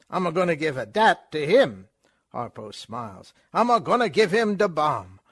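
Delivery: put-on fast, high, brash stage Italian accent; hammed-up vaudeville Italian accent, pushing the joke; Chico Italian accent. Chico offering Groucho Delaware, in a loud stage Italian accent.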